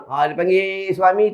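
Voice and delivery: normal talking voice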